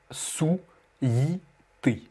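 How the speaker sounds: sou-yi-té slowly, each syllable separate; exaggerated breakdown. Shown as a demonstration. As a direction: stretch sou-yi-té into three long pieces. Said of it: "je le décompose bien et j'exagère". (sou-yi-té décomposé lentement, en exagérant)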